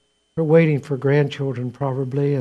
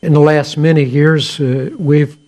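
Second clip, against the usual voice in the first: emphatic preaching; 6 dB louder than usual. Real, not acted. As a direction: drive the point home to the congregation.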